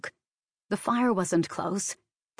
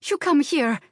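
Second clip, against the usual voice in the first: put-on high, loud Slavic-accented urging; insistent Slavic accent; 9 semitones higher, 5 dB louder than usual. A narrator's reading. Maid offering employer refuge, insistent Slavic accent. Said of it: "her accent growing stronger".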